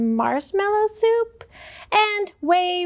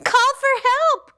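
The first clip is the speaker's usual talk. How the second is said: crying out; 9 semitones higher than usual. Acted. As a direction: cry out in a panic. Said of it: "cried Bebe".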